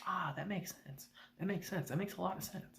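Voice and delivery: soft dim-witted agreement; gullible murmur. Retold or imitated. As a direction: mumble agreement like a fool who buys it. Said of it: "But of course, Uncle's like".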